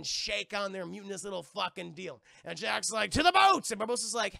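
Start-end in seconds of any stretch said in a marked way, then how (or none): 3.1-3.7 shouted high and loud; mock rallying shout. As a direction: bellow it like a captain ordering his crew. Jack's rallying cry retold.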